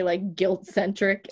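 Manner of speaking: usual speaking voice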